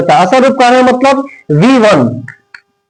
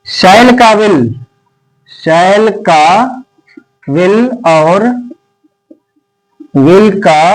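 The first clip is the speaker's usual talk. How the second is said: slow, lower dictating voice with long gaps; teacher dictating to the class at x0.5; slow dictation; 3 semitones lower, slower than usual. Acted. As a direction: read it out chunk by chunk for note-taking. Dictating the poem slowly.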